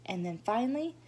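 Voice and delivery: ordinary delivery, nothing marked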